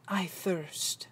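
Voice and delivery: low, whispered murmur; barely voiced, hushed and calm. Jesus on the cross saying he thirsts, very calmly. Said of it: "Very calmly".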